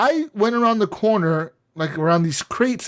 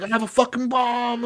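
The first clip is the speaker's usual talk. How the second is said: higher, put-on voice for the bomber in the video; mock bomber threat; 6 semitones higher, 3 dB quieter than usual. Retelling the Patriots trailer, he voices the bomber in a higher, put-on voice. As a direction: play the unhinged guy with a bomb. Guy with bomb in office.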